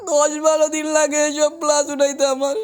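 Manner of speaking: ordinary delivery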